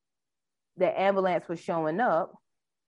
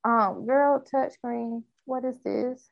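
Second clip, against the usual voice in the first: higher, exasperated complaining; 5 semitones higher than usual. Real, not acted. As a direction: vent at the glitchy screen. Complaining about the touchscreen.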